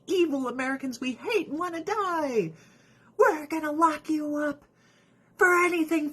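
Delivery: high-pitched villainous parody; hammed-up high prosecutor; mock threat. Voicing Trump's prosecutors threatening him.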